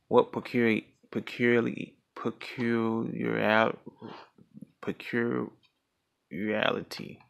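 stumbling over "peculiarity", restarting it slowly; tongue-tied. Real, not acted. Reader tripping on a tongue twister, real halting attempts at "peculiarity". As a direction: break off and retry "peculiarity", drawing out the syllables. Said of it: "that's a tongue twister".

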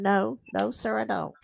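usual speaking voice

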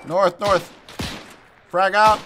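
loud high grenade warning; shouted callout. Acted. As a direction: yell the frag out over gunfire.

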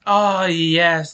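loud, bursting cheer at the goal; joyful cheer. Real, not acted. Goal scored in the match.

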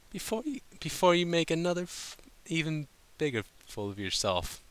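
refined, clipped warning; prim lady. A narrator's reading. Rarity warning Applejack not to embarrass herself, prim. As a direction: mutter a proper rebuke. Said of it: "Rarity interrupted primly".

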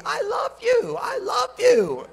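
very high, shouted mock cry of love; yelling after him. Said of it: "she's yelling out after me".

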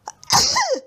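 high, loud sneeze. A narrator's reading. Teddy interrupted while giving his name, sudden sneeze. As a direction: sneeze sharply, cutting off the introduction. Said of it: "He sneezed and spluttered".

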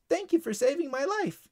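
high, thankful bird voice; little bird. Acted. Bird thanking Tyler for saving it, grateful.

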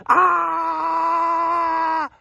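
long, high held "ah" scream; storybook scream, drawn out and steady; mock scream. Reading to a child as snake runs away, mock scream.

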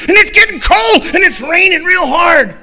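loud and high storytelling; booming storm narration; dramatic storm. A narrator's reading. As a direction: paint the storm big and loud. Storm rolls over ranch.

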